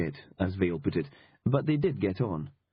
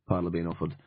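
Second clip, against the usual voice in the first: plain reading voice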